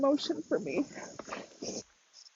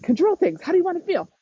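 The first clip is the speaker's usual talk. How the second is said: higher, pushy inner drill-sergeant voice; bossy self-talk; 7 semitones higher than usual. Retold or imitated. A coach mocking her controlling inner voice, pushy and higher. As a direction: bark orders at your own feelings, half-mocking. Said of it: "normally I'm like".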